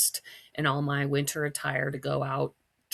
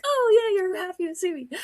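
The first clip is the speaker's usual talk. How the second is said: very high, loud mock dog voice; dog's voice; 6 dB louder than usual. Dog to owner after being scolded, high happy voice. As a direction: squeal it as the relieved dog. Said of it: "He was like, he, like, hung his head".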